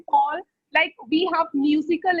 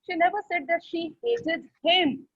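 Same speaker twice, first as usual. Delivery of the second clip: him set apart and leaned on at the end; stress on him; 2 semitones higher than usual. Shown as a demonstration. Teacher showing last word emphasized, stress on him. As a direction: save the emphasis for the final "him". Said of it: "if I give rise in the last word".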